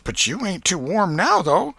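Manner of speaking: aunt slyly questioning nephew Tom about school; Aunt Polly. A narrator's reading. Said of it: "The old lady ... and said".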